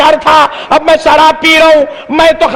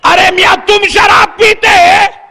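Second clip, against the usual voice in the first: shocked shout; 6 semitones higher than usual. Retold or imitated. Mosque president catches the drinker at the mosque, shocked shout. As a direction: call him out, scandalized.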